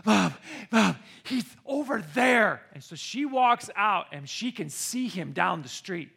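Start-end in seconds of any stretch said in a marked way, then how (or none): 0.0-2.6 out of breath. Retold or imitated. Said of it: "I'm all out of breath".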